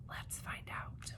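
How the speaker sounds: very soft, slow whisper; suspense whisper. Shown as a demonstration. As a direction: hush it as a teaser. Teacher builds suspense.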